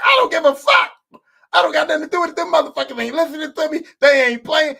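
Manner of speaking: high, loud imitated outburst; snapped out. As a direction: act out a coach yelling in defiance. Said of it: "He snapped out".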